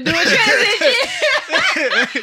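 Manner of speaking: teasing through laughter; laughing tease. Real, not acted. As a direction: laugh it out mockingly.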